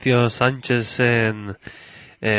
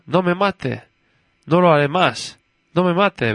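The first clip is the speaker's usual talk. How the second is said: higher-pitched mock begging; imitated pleading cry; 7 semitones higher than usual. Girl imitates the condemned man begging, mock pleading cry. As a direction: lightly mimic a condemned man's plea. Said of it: "Y gritaba".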